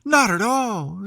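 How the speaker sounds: put-on a young girl complaining, a bit breathless with fuss; Alice, voiced lightly. A narrator's reading. Alice answers the cat.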